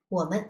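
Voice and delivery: 我们 with a short, toneless 们; lighter tone on 们. Shown as a demonstration. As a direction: let 们 go lightly in 我们. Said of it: "in the lighter tone".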